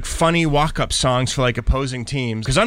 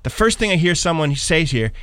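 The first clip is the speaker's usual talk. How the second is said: usual speaking voice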